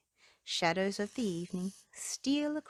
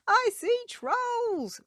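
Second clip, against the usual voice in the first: high, loud, slow storybook scream; 6 dB louder, much slower than usual. Acted. Shocked human seeing trolls, a high loud scream. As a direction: shriek it out in horror. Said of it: "The human being screamed".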